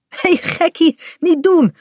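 high, light telling-off; playful scolding. A narrator's reading. Kaat tells her wet dog off for shaking, playfully scolding. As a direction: scold a beloved pet without meaning it.

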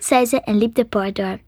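plain reading voice

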